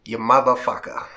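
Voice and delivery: loud playful jab between bursts of laughter; laughing insult. Real, not acted. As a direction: laugh it out loud at your friend. Laughing jab at brother Stu.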